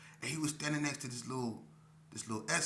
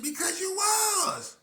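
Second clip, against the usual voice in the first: high, slow, loud innocent protest; young man naively defending his words; naive insistence; 8 dB louder, much slower than usual. Retold or imitated. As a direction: say it high and slow like it's obvious. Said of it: "I said".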